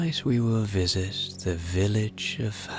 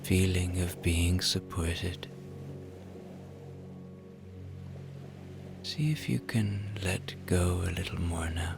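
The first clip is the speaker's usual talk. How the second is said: guided relaxation; 8 dB quieter than usual. Acted. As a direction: ease them toward sleep, unhurried.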